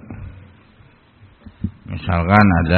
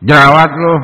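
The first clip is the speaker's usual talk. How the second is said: Jerawat loh with the word jerawat jumped up high and loud, teasing; mocking jerawat; 7 semitones higher, 8 dB louder than usual. Shown as a demonstration. Teacher shows how a taunting tone changes the meaning, mocking. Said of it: "kan beda lagi itu".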